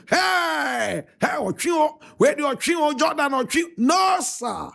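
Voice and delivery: high shouted calls from the stands; shouting at the players, high and excited; mock yelling. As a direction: yell like a fan at the stadium. Acting out shouts at the match.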